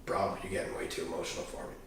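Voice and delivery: soft, breathy, unbothered deadpan; deadpan therapist. Retold or imitated. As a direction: toss it off softly like it's no big deal. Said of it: "He goes".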